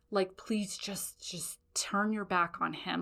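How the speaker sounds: hushed, slow plea; whispered warning; urgent whisper. Retold or imitated. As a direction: beg him softly to listen.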